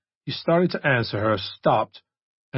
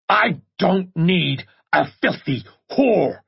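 loud, slow enraged scream; 8 semitones higher, 5 dB louder, slower than usual. Acted. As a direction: rage at her from above. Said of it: "he screamed".

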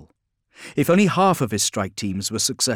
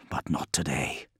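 low whisper; quiet resolve, whispered; whispered thought; 8 semitones lower, 9 dB quieter than usual. Acted. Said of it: "thought Guilliman".

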